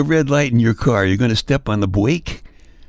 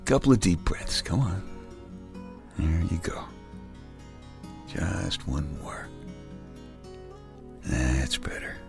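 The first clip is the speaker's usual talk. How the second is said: tucking in at bedtime; 4 semitones lower, 6 dB quieter than usual. Acted. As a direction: lull the listener gently to sleep. Tucking listener in to sleep.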